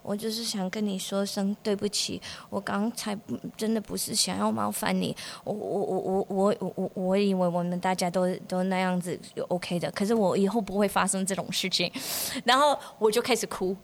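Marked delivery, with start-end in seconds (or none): 0.0-11.9 much softer, lower, halting sorry; timid apology. Retold or imitated. 学生向老师道歉，又小声又结巴. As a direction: apologise meekly with a stammer. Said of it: "他说".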